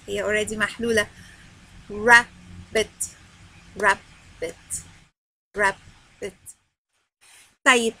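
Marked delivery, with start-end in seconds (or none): 1.9-3.1 "Rabbit" split into rab... bit; "Rabbit" syllable by syllable with a gap; syllable demonstration. Shown as a demonstration. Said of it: "بقولها على مقطع واحد أو اتنين". 3.8-4.8 "Rabbit" as two separate beats, rab... bit; syllable demonstration. Shown as a demonstration. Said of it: "بقولها على مقطع واحد أو اتنين". 5.5-6.3 "Rabbit" as two separate beats, rab... bit; syllable demonstration. Shown as a demonstration. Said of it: "بقولها على مقطع واحد أو اتنين".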